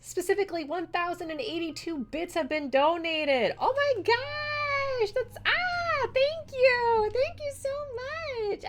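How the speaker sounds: very high, drawn-out squeals; ecstatic thanks. Real, not acted. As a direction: gasp and squeal at a completed goal. Viewer completed the donation goal.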